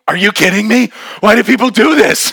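high, loud mock outrage; incredulous protest. Girl protests at hours of climbing.

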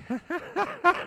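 high cackle imitating the jingle laugh; reenacted laugh. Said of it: "Can you reenact it?".

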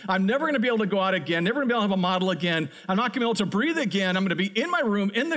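fast, piling-up mock despair; rushing dread. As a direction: rattle off the doom list in a rush. Imagined doom, rushing.

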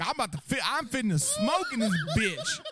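high, loud laughing shout; mock rowdy shout. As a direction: holler it through laughter. Voicing customer threatening to smoke.

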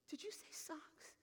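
slow, whispered question; hushed disbelief. Retold or imitated. Woman checks what pastor said, hushed disbelief. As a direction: ask it slowly in a stunned whisper.

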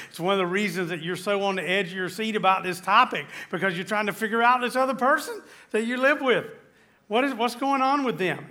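fervent preaching. Acted. Pastor urges couples to understand their spouses, building fervor. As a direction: preach it with rising fire.

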